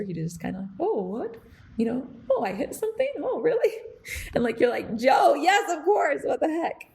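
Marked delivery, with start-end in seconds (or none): 0.8-3.7 high, swooping voice of an oblivious dad; absent-minded professor. Retold or imitated. Dad obliviously asking about the car he hit, mock absent-minded. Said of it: "the absent-minded professor". 5.0-6.9 loud, higher exasperated retort; mock exasperation. Exasperated at absent-minded dad who hit a truck, mock outburst.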